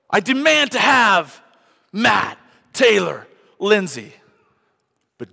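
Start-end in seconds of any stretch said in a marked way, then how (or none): 0.1-4.1 much higher and much louder, slow, roaring; Satan shouting his demand before the throne; Satan's demand. Acted. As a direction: bellow the devil's claim like a tyrant.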